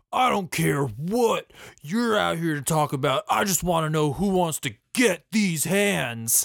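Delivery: a hint of a tough wrestler taunting; mock heel wrestler trash talk; wrestler promo. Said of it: "He just comes out and he goes".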